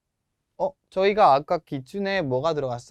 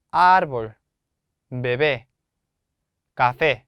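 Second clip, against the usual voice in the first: árbol with a firm first syllable, bebé and café on the last; syllable stress; 2 semitones lower, 5 dB louder, much slower than usual. Shown as a demonstration. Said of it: "힘을 줘서 발음을 해야". (árbol은 첫 음절, bebé와 café는 끝 음절에 힘주어)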